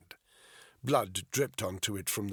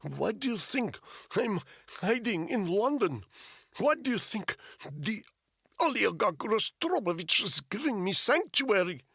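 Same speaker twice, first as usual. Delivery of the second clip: put-on high, insistent Russian-accented English, rising louder; thick Russian accent; 12 semitones higher, 4 dB louder than usual. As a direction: press the question in a heavy Russian accent. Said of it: "with a thick Russian accent".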